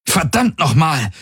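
high, urgent curse; exasperated call. A narrator's reading. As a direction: cry out in exasperation, pitched well up. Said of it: "rief seine Projektion". (aufgebracht gerufen)

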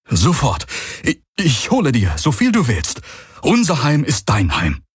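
put-on high, excited young voice; eager servant. A narrator's reading. Young villager rushing to fetch food, eager. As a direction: leap to obey, tripping over the words. Youth jumps up to serve guest.

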